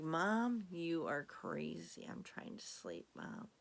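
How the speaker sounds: whispered annoyed cat voice; sleepy whispered cat. Pretend. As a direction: hush it like a cat who wants to sleep. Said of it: "She's looking at me like".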